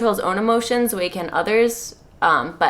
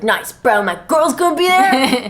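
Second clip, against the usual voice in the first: bro voice; 4 semitones higher, 4 dB louder than usual. Acted. [name] bro voice.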